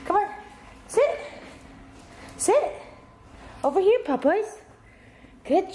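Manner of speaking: very high, sing-song sit for the dog; high dog command. Real, not acted. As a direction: call the dog over, way up high. Calling dog to the bed.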